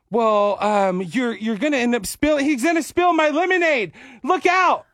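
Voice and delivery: put-on rising into a high kid complaint; bossy toddler scolding; little girl voice. Girl scolding a dad over spilled lemonade, high kid voice.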